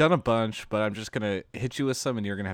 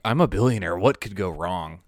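mock billionaire; 8 dB louder than usual. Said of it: "you're like".

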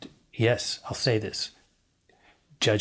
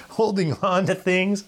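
laughing; 7 semitones higher than usual. Real, not acted. Laughing while preaching.

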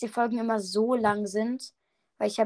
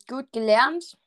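usual speaking voice

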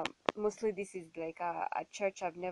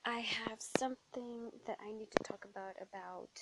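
whisper; 3 semitones higher, 4 dB quieter than usual. Real, not acted. Quiet confiding opener.

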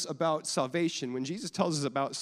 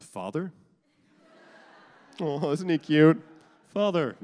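mock formal baby; 3 semitones higher, 9 dB louder than usual. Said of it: "they don't come out and they're like".

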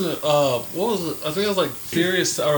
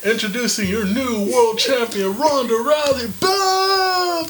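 ring announcer; 7 semitones higher, slower than usual. Pretend. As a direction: belt it out like an arena announcer. Mock ring announcer intro.

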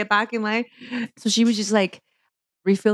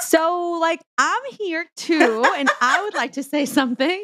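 mock timid interrupt; 8 semitones higher than usual. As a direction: sheepishly claim the floor.

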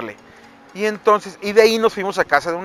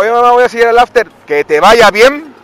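cheeky goodbye; 6 semitones higher, 9 dB louder than usual. Retold or imitated. Telling mom leaving.

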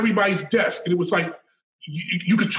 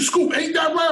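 retold shout; 9 semitones higher than usual. Naughty by Nature crew demanding he agree, mock shout. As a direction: shout the question like an angry crew.

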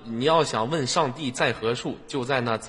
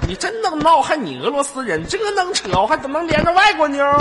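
high loud mock outrage; excited ranting; 13 semitones higher, 9 dB louder than usual. Real, not acted. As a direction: rant it loudly in disbelief. Ranting disbelief at caller. (激动地大声嚷嚷)

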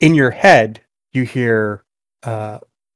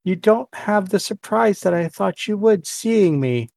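lightly voiced queen, much higher pitch; Elven Queen figure; 9 semitones higher than usual. Robed queen addresses her bound captive, higher-pitched figure voice. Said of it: "she's like".

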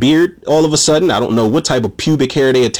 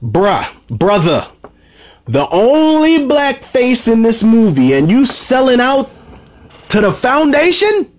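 lost it; 6 semitones higher, slower than usual. Real, not acted. Reviewer ranting at Darius for siding with the Foundation, high outraged voice. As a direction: yell at Darius like he betrayed you. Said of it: "this is when I lost my shit".

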